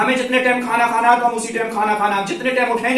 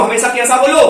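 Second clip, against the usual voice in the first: ordinary talk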